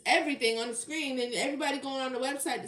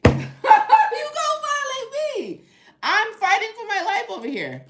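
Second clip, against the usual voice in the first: laughing, shrieking outrage, much higher and louder; outraged shout; 11 semitones higher, 12 dB louder than usual. Real, not acted. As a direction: scream it in mock horror.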